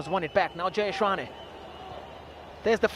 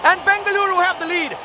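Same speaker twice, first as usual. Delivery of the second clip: long goal yell; 7 semitones higher than usual. Real, not acted. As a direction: roar the goal home. Goal scored in cup final.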